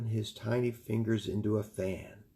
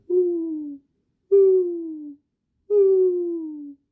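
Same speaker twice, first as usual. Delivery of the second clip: much higher, louder hooting owl call; drawn-out owl hoots ringing out; 11 dB louder, much slower than usual. Acted. Hoot owl rings in year.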